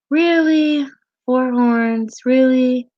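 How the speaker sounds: high-pitched, amazed questioning; astonished, emotional, voice lifted high; moved disbelief. Real, not acted. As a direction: exclaim high, overwhelmed with surprise. Overwhelmed, disbelieving reaction to chat.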